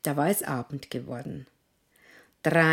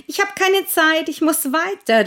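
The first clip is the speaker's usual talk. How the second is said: hoch und laut gerufen; rufend; 12 semitones higher, 9 dB louder than usual. Acted. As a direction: eilig hinausrufen. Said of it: "rief der Pfannkuchen". (lauter Ruf)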